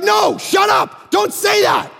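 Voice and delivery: protesting shout. Retold or imitated. Refusing the free horse for his daughter, high excited shout. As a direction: push the temptation away with a yelp.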